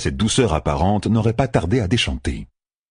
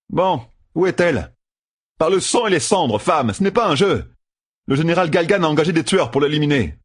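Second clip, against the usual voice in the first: high, urgent demand; impatient young gambler; 9 semitones higher than usual. Acted. Matt demanding to know where she is, urgent. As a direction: insist that this is no game.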